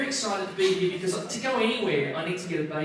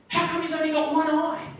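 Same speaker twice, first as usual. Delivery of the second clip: panicked blurt; 8 semitones higher, 6 dB louder than usual. Retold or imitated. Panicked dad blurts to doctor.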